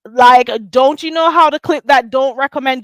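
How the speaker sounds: loud, high, fed-up questions; exasperated. Real, not acted. Scolding critics who won't unsubscribe, loud and exasperated. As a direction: demand answers from the haters.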